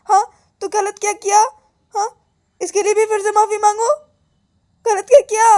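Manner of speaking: high, loud whining protest; aggrieved complaint. Acted. Girl asked to apologise again, complaining to God, aggrieved.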